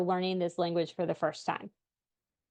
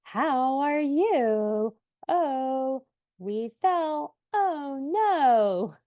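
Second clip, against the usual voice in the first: put-on high, slow sing-song coo to a toddler; baby talk; 6 semitones higher, much slower than usual. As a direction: exaggerate every word like a cooing parent. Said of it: "talking to them like this".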